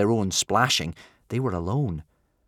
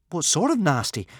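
higher, mildly nervous question; 8 semitones higher, 4 dB louder than usual. A narrator's reading. Boy questioning his dragon, nervous. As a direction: ask with a nervous gulp in mind. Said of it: "swallowed Hiccup".

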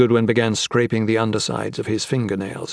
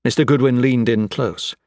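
ordinary delivery

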